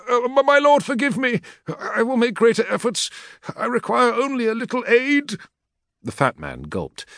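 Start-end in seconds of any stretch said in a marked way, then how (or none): 0.1-5.4 much higher, fast, nervous pleading; a cowering clerk stammering excuses; frightened logistician. Acted. Fat logistician begging the War Master's equerry, high frightened voice.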